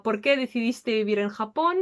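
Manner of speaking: ordinary talk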